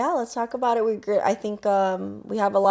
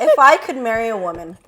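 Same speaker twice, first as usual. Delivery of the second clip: ordinary talk, nothing marked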